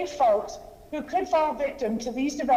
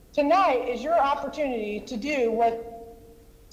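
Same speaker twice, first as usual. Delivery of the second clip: ordinary talk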